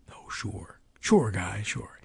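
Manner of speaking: dry, unvoiced sarcastic mutter; sarcastic whisper. Real, not acted. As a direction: whisper it with a knowing sneer. Sarcastic whispered aside to himself.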